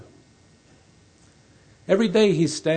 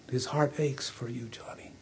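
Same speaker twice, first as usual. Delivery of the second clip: whispered, soft and tender; 7 dB quieter than usual. Acted. Neighbor telling Johnny his father misses him, whispered. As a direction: let the father's love slip out in a whisper.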